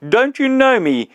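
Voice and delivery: high, loud greeting shout; bright spirit shouting a glad greeting; joyful shout. Acted. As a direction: call out to an old friend, high and loud. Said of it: "he shouted to the ghost".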